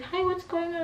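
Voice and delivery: high-pitched friendly greeting; lilting high hello; sweet nurse. Retold or imitated. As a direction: imitate a chirpy, helpful nurse.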